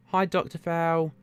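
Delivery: mock Cockney lad greeting a mate; Cockney accent. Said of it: "Cockney all the way from the East End".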